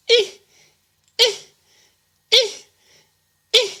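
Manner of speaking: short clipped grunting eh sounds in a row; mouse mating squeaks. Retold or imitated. Mimicking the man mouse in the brother's shed, rhythmic grunts. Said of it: "Man mouse".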